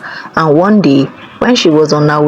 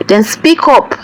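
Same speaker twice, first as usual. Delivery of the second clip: impatient command; 7 semitones higher than usual. Acted. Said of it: "the king said".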